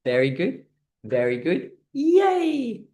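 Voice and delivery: mock client praise with high yays; high squeaky yay; praising; cheery praise. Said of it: "And then say".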